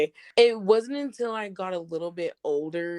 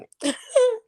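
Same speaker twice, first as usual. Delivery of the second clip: short high squeal of laughter; nervous high giggle; 6 dB louder than usual. Real, not acted. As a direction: let a high laugh escape. Laughing before a painful story.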